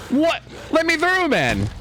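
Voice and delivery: high-pitched outraged yelp; blocked and outraged. Real, not acted. Streamer stuck in a doorway, yelping high in disbelief. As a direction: squeal it when you can't get past.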